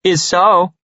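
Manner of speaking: high, teasing comeback; playful contradiction in a high voice. A narrator's reading. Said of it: "smiling teasingly at him".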